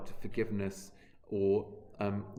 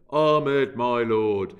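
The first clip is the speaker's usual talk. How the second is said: louder mock class chanting "Ahmed, my lord"; lame class chorus; 10 dB louder, much slower than usual. Teacher mocking a whole class, loud flat chorus. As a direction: ham up a bored class reciting "Ahmed, my lord" in unison. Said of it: "ask a whole class to say".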